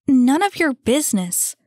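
high, loud defensive comeback; defensive retort. Acted. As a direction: shut the nosy kid down. Annie snaps back at kid.

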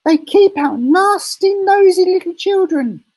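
shrill hammed-up hag; nasty old woman. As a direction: screech it out like a mean old witch. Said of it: "she used to say".

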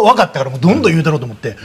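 ordinary talk

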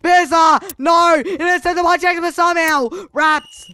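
high shrieking disbelief, fast; excited screaming. Real, not acted. Gamer screams as Jackson intercepts, high and frantic. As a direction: scream as the ball gets picked off. Interception happens live in game.

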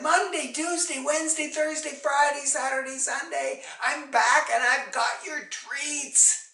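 put-on high, loud singsong call to the children. A narrator's reading. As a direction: call through the house that you're back. Mother calls children on coming home.

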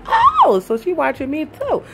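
high, surprised, laughing gasp. Retold or imitated. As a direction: squeal with delighted surprise. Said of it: "I was like".

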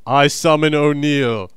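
loud, slow proclamation; slow mock-epic incantation; theatrical summons. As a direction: summon him in a grand, drawn-out call. Theatrical summoning, first take.

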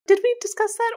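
sudden high, surprised question. Real, not acted. To her co-host, questioning the figures, surprised. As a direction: caught off guard, pitch jumps way up.

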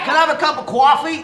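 New York accent. Shown as a demonstration. Repeating the coffee order for teasing friends, mock New York accent. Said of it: "To New Yorkers".

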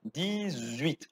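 Dix-huit linked as dizuit. Shown as a demonstration. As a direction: join Dix-huit together. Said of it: "é pra juntar".

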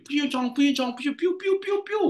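high-pitched gibberish imitation of a Western accent; mock Mak Saleh accent. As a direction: mimic a Mak Saleh accent in squeaky nonsense syllables. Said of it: "dia memang cakap macam Mak Saleh".

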